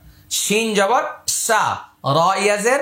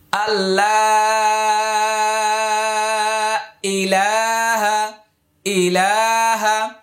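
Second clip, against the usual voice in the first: slow, drawn-out Quranic recitation; much slower than usual. Shown as a demonstration. Teacher modelling the phrase for learners to memorise, slow chant. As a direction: draw each vowel out like a reciter. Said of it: "এবার মুখস্থ করে নিন।".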